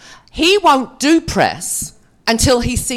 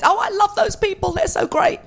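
mock gushing Hollywood actor, higher; 13 semitones higher than usual. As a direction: ham up a fake-sincere actor's praise. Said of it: "every actor in Hollywood always says".

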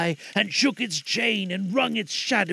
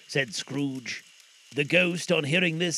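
usual speaking voice

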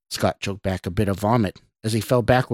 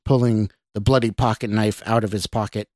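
ordinary delivery

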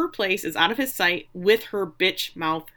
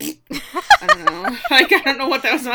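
louder, higher, through laughter; laughing; 6 semitones higher, 5 dB louder than usual. Real, not acted. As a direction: giggle out the words. Laughing at her own joke.

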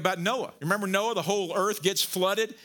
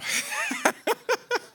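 high-pitched burst of laughter; 3 dB louder than usual. Real, not acted. Preacher happy now after his outburst, laughing high. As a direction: break into a high, pulsing laugh.

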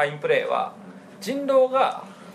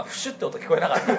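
ordinary talk, nothing marked

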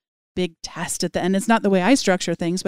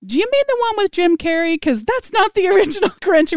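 much higher, playfully incredulous; mock disbelief; 12 semitones higher, 5 dB louder than usual. Teacher querying students about the original Grinch, mock disbelief. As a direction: challenge them in amused, high-pitched disbelief.

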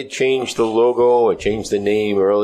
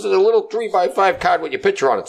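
cop in the joke; 8 semitones higher than usual. Retold or imitated. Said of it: "She goes".

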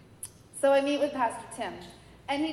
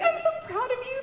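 proud; 5 dB louder than usual. Retold or imitated. Friend's proud reply.

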